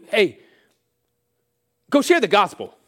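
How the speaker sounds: loud, high call-out; acted challenge. Preacher sends listeners to share the gospel, loud urging. Voices giving a daunting challenge.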